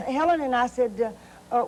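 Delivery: normal talking voice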